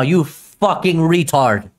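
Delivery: slow, drawn-out angry abuse; exasperated insult. Real, not acted. Streamer berating the man on screen, angrily exasperated. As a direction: stretch each insult out in disgusted anger.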